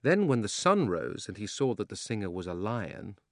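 plain reading voice

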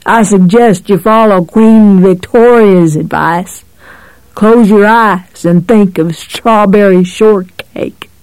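sly, grinning cop voice; amused menace, lightly voiced. A narrator's reading. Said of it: "the cop said, grinning".

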